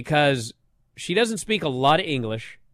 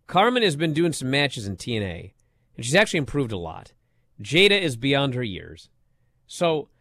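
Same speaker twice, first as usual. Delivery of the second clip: usual speaking voice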